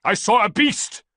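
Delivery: loud, high, slow fervent cry; ranting. A narrator's reading. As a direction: rant the vision on and on. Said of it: "Theodotus continued to rant".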